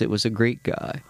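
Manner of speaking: normal talking voice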